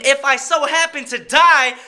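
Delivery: half-joking pastor voice; mock preaching. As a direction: build it like a sermon. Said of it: "I feel like a pastor right now".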